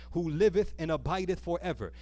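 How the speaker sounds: normal talking voice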